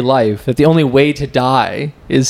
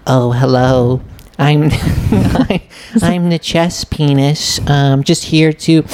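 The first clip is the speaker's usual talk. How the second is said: polite little man; 3 semitones higher, slower than usual. Pretend. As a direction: introduce yourself as a timid, courteous little fellow. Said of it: "Saying it with that voice".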